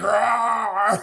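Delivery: loud, high drawn-out roar; mock roar. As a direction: roar like an outraged buddy. Imitating buddy's roaring reaction.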